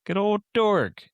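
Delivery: excited cheer. Real, not acted. Fond cheer for favourite comic.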